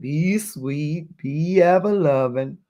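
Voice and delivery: drawn-out Southern drawl; dad's Southern drawl. Retold or imitated. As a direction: imitate dad's Southern accent. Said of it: "say it in his southern, southern drawl".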